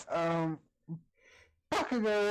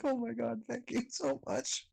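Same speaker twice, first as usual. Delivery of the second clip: overly grateful; 10 semitones higher than usual. Acted. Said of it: "I was like".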